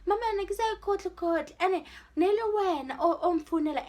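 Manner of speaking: high, loud, hurried mock protest; retold protest. Child defending herself to mom, acted protest. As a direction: argue back as the accused child.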